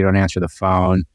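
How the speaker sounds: ordinary talk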